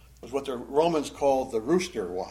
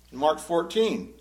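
usual speaking voice

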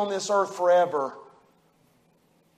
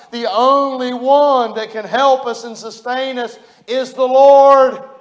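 slower and louder, swelling onto "the Lord"; preaching climax; 4 semitones higher, 5 dB louder than usual. Acted. Pastor closing the sermon on sorrow, rising preaching climax. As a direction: build slowly and land hard on "the Lord".

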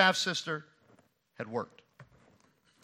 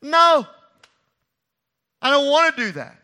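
loud, high No; emphatic refusal; 8 semitones higher, 9 dB louder than usual. Retold or imitated. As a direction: cry out the refusal. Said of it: "His sister says".

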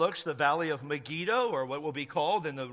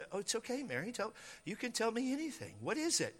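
soft, higher-pitched coaxing; hushed and tender, as if comforting a partner; reassuring; 4 semitones higher, 10 dB quieter than usual. Acted. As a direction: gently invite her to tell you anything. Joseph reassuring Mary in retold story.

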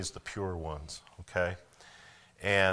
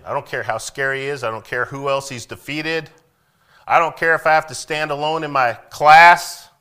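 higher and louder, building to a shout; preacher shouting a defiant stand; defiant preaching; 8 semitones higher, 15 dB louder than usual. Acted. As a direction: rise into a fiery declaration. Preacher voicing defiant king.

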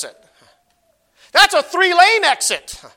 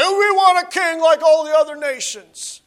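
higher mock whine of the people; whining Israelites; 7 semitones higher, 3 dB louder than usual. Preacher mimicking Israel demanding a king, higher mock whine. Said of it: "when they said".

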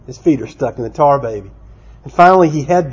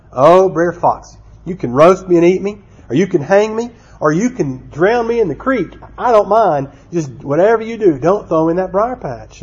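mock pleading; 4 semitones higher than usual. Trapped rabbit bargains with Brer Fox, mock pleading. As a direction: plead in a higher pitch, hamming up the dread.

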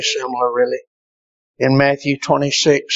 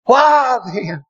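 fiery preaching; 9 semitones higher than usual. Acted. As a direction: pound the congregation with it. Preaching at full force.

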